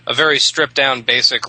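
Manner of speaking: usual speaking voice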